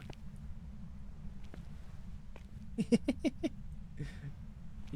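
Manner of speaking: long, high-pitched laughing fit; real laugh. Real, not acted. Player amused at the ghost orbs by the door, long high laugh.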